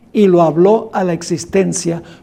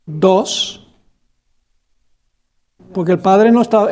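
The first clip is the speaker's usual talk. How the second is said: usual speaking voice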